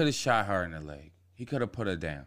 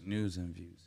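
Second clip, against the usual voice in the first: tipsy; 5 semitones lower, 10 dB quieter than usual. Real, not acted. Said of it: "I'm sorry, I'm a little tipsy".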